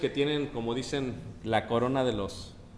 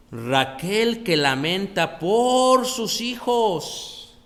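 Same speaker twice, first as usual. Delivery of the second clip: slow lament; 6 semitones higher, 6 dB louder, much slower than usual. Acted. Reading Rachel's lament slowly.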